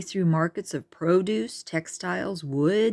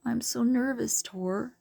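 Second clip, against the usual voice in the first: nervous; 7 semitones higher than usual. A narrator's reading. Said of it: "a frown came across her face".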